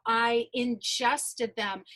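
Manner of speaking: ordinary talk, nothing marked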